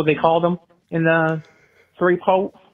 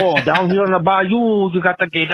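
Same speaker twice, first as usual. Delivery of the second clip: mock a Louisiana swamp local; Cajun accent. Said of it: "They're like".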